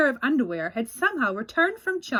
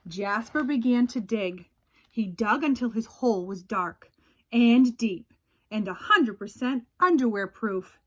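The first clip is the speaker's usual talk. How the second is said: usual speaking voice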